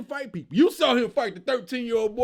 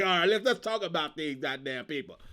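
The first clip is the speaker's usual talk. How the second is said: lower, lazy mock host voice reading names off a phone; a lightly voiced imitation of the host, scrolling and griping; imitating him scrolling; 8 semitones lower, 4 dB quieter than usual. Imitating the podcast host scrolling his list, low mock voice. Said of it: "he had to scroll like".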